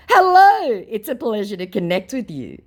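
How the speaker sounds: high, bright mock-polite greeting; prim scammer greeting. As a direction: put on a sickly sweet stranger. Scammer's sweet greeting voiced.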